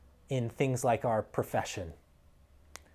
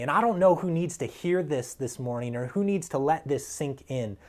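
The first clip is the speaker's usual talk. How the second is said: normal talking voice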